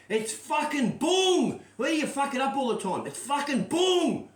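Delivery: mock furious yell; exasperated yell. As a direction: bellow it at the friend who ruined the joke. Yelling at friend's botched joke.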